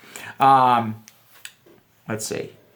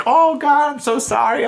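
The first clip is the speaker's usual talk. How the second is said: flustered waitress; 12 semitones higher, 4 dB louder than usual. Retold or imitated. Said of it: "she was like".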